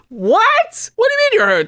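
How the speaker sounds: very high, squeaky mock disbelief; hammed-up high-pitched incredulous protest; mock boyfriend. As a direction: whine it in a squeaky put-on voice. Mocks the boyfriend's clueless reply.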